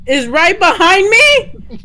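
scared, ghost right behind; being chased. Real, not acted. Ghost is chasing her.

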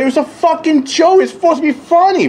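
high, mocking "It was a fucking joke"; high mock voice of Paige, "It was a fucking joke"; mocking her. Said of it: "She left a comment saying".

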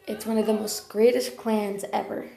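ordinary talk, nothing marked

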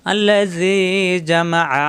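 slow chanted tilawat with long drawn vowels; Quran recitation. Shown as a demonstration. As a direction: recite slowly, stretching each vowel. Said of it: "আলাদা পড়লে".

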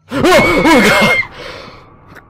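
high screaming in fright; scared horror game streamer. Real, not acted. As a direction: shriek at the jump scare. Jump scare.

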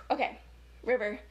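usual speaking voice

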